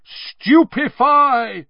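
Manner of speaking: loud, slow, high roar. A narrator's reading. Twenty wizards casting at once, a slow loud roar. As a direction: bellow the spell out long. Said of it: "roared twenty voices".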